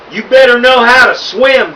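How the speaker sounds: shouted threat. A narrator's reading. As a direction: shout it down at the small girl. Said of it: "Duke shouted".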